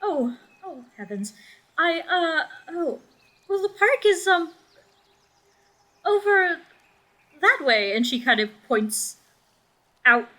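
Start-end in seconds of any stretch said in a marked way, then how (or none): 0.0-7.9 much higher, fluttering and apologetic; frightened mother fumbling to point the way; flustered and scared. Acted. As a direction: stammer out directions, flustered by the stranger. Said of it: "She looks a bit terrified".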